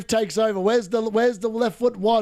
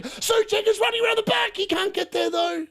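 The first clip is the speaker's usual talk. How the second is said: excited call; 10 semitones higher than usual. Real, not acted.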